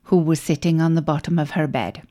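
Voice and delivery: ordinary delivery, nothing marked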